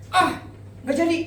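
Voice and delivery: high-pitched mock fluster; imitated panicking wife. Wife abruptly cancelling before the party, imitated high fluster. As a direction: back out in a sudden, acted mild panic.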